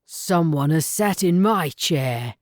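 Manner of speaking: lightly voiced growling bear father; a hint of a gruff papa bear; storybook papa bear, mildly growled. A narrator's reading. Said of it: "growled Father Bear loudly".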